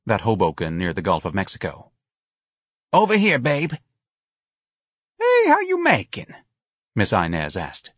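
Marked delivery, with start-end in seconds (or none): 2.9-3.7 high New Orleans call for attention; New Orleans accent. A narrator's reading. Calling to the saleslady. 5.2-6.3 high-pitched New Orleans shop greeting; chummy saleslady greeting. A narrator's reading. Saleslady greets a regular customer.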